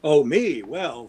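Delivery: ordinary delivery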